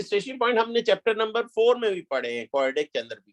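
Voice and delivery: normal talking voice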